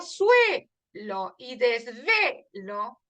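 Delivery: suelo y desvelo said very slowly; slow demo words. Shown as a demonstration. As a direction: stretch out suelo y desvelo to show the rhyme. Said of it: "lento para mostrar la rima". (palabras alargadas para mostrar la rima)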